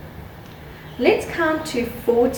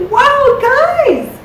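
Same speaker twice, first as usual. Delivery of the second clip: excited; 14 semitones higher, 8 dB louder than usual. Acted. Teacher cheering the children.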